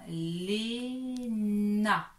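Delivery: "Léna" slow, each syllable held; sounded-out name. Shown as a demonstration. Teacher reads syllables to attach, slow and drawn out. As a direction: stretch "Léna", loud on the second syllable. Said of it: "il faut attacher".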